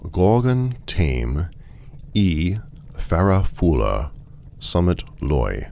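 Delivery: slow, spaced-out reading of strange words; careful recitation. Acted. Traveller reading a passphrase off an automaton, slow and careful. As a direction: recite it phrase by phrase, deliberately.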